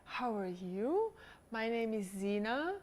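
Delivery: slow, soft, drawn-out delivery; unhurried, slow intro; slowed demo. Shown as a demonstration. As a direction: model it slowly and gently. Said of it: "Slow down".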